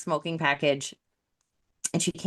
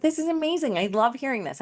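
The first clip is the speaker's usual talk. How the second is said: bright, much higher burst of delight; excited; 8 semitones higher than usual. Retold or imitated. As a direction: gush with sudden joy at good news. Thrilled by client's progress.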